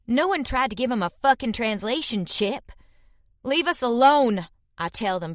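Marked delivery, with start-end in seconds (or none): 3.4-4.5 higher, louder furious command. A narrator's reading. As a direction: hurl it at them in fury.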